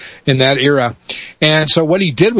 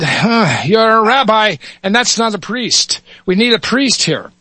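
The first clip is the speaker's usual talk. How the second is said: higher, doubtful Irish brogue; Irish cop; 8 semitones higher than usual. Acted. Officer Muldoon doubts the rabbi, Irish accent. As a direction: scoff lightly in an Irish lilt. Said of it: "switch between dialects here".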